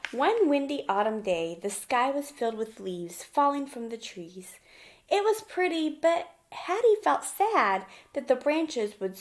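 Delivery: put-on bright, lively read-aloud for little kids; gentle children's story narration; storybook reading. A narrator's reading. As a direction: read it like a picture book at story time. Reading picture book to children.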